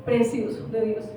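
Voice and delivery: soft, earnest exhortation over music; preaching over music. Acted. A quiet pastor pleading with the men to rise in prayer. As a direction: pastor urging the men to pray.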